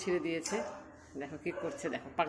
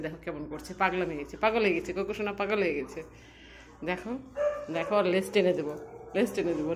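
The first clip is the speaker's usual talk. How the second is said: ordinary talk, nothing marked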